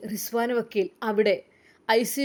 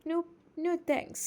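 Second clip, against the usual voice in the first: higher, much softer disguised refusal; 6 semitones higher, 9 dB quieter, much slower than usual. Acted. Rasiya hides her voice from husband, higher and softer. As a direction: mask your voice so he won't know it. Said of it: "അവൾ ശബ്ദം മാറ്റി".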